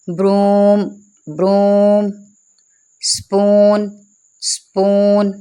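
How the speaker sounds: "ब्रूम" and "स्पून" with a stretched oo; long oo vowel. Shown as a demonstration. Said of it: "इसे ज़रा खींच के पढ़ेंगे". ("ब्रूम" और "स्पून" में ऊ को खींचकर)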